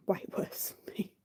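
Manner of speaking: soft whispered aside; whispered tease. Real, not acted. Confiding Beanie sings worse, whispered tease. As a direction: whisper it like a secret. Teasing partner's singing.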